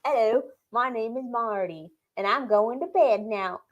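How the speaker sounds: slow, soft mock Australian accent; Southern Australian accent. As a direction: put the pet to bed in drawling Australian. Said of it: "she was doing Southern Australian".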